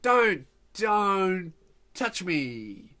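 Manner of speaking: very slow, stretched-out warning; drawn-out warning. Acted. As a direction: warn them off, dragging out each word. Hates being touched, warns her.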